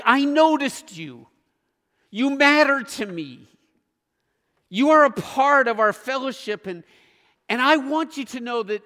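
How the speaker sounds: speaking straight to a newcomer with open affection; heartfelt greeting. Acted. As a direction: tell each person they matter. Voices a warm greeting to others.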